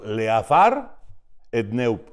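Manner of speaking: Leafar et Neup, [name] te Puen reversed; backwards speech. Shown as a demonstration. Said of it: "yo hablo al revés".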